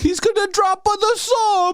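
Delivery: high put-on mocking voice. Friend mocks a movie character's line, high mock voice. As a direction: squeak it out as a mock impression. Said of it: "And he's like".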